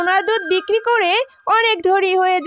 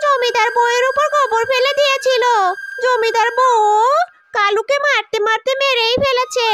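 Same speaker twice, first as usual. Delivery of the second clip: higher-pitched villager voice; villager breaking bad news; 4 semitones higher than usual. Villager tells Jiya her cow was killed, high-voiced news-bearing.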